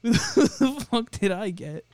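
laughing the words out. Real, not acted. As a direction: ask it while still giggling. Laughing in disbelief.

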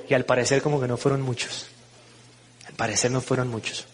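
low, soft afterthought; understated, quiet regret; rueful aside. Real, not acted. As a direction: drop the voice, falling away.

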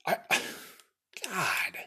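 whispered exasperated sigh; weary sigh. Real, not acted. Worn out by the census saga, with a whispered sigh. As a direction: let it all out on a sigh. Exhausted after the rant.

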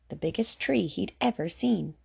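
ordinary delivery

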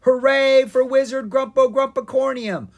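high, full-volume hooray; cheering. A narrator's reading. Ogres, elves and trolls hailing the wizard, cheering. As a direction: cheer it out with the crowd. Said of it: "trolls cheered".

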